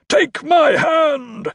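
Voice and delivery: slow, high, shouted demand; crazed sorcerer's drawn-out yell; crying out. A narrator's reading. Carstairs holds out his hand to Crow, shouting. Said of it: "cried Carstairs".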